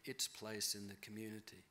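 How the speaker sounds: ordinary talk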